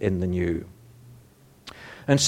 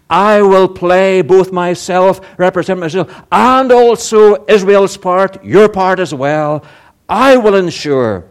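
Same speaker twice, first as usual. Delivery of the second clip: higher, louder, driving sermon voice; fervent preaching; 7 semitones higher, 7 dB louder than usual. Acted. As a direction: preach it with conviction to the flock. Preaching in God's voice.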